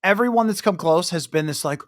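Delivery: ordinary talk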